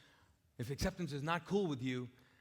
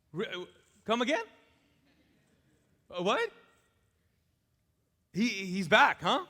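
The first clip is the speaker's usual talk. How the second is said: incredulous brother; 3 semitones higher, 9 dB louder than usual. Acted. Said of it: "And he's like".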